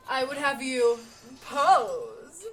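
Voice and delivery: loud, hammed-up command; overblown shout. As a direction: bellow it like an overexcited master. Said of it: "Okay, buddy, calm down".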